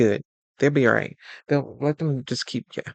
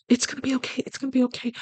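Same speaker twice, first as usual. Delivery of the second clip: high soothing reassurance; 10 semitones higher, faster than usual. Retold or imitated. Soothing upset partner.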